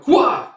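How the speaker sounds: high, loud comic-book yelp; startled cry. Retold or imitated. Narrator acts a character snagged by a rope, startled cry. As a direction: cry out like you've been snagged.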